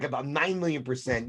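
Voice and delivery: usual speaking voice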